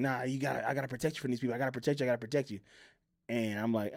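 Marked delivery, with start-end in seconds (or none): 0.0-2.6 hushed excuse. Retold or imitated. Mother's evasive excuse acted out.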